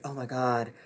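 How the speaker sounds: usual speaking voice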